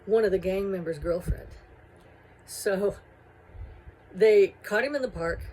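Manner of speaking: ordinary talk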